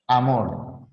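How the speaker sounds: Amor with the mor stressed. Shown as a demonstration. Teacher settling the stress of amor. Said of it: "¿Es amor o amor?".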